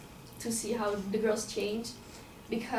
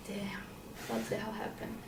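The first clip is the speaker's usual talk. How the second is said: hushed, breathless whisper; stunned whisper at the sight; shocked whisper; 4 semitones lower, 9 dB quieter than usual. Retold or imitated. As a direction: whisper it so she won't startle. Shocked on finding the girl.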